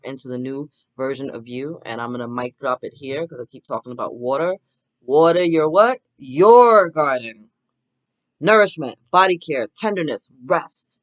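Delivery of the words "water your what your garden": loud, drawn-out call, then answer it yourself; loud preacher call-out. Acted. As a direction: belt out your garden.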